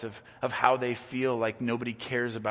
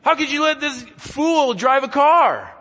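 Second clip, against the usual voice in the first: mock desperate cry to God; 13 semitones higher, 14 dB louder than usual. Pastor imitating a frustrated churchgoer, loud exasperated plea. Frustrated prayer quoted in sermon.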